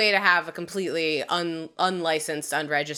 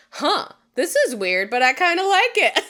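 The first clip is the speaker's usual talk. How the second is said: rising higher and louder into delight; intrigued leering man; 9 semitones higher, 5 dB louder than usual. Retold or imitated. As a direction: play a guy weirdly turned on. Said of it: "men's reactions to the bedazzled neck brace were like".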